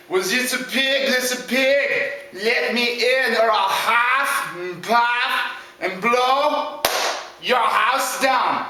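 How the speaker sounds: slow, deliberate villain demand; drawn-out storybook wolf; threatening big bad wolf. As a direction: stretch out each threat with menace.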